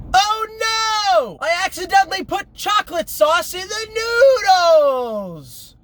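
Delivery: hammed-up panicked child voice; mimicking a kid's cooking video, squealing; mock little girl. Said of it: "I'm going to do for you a rendition".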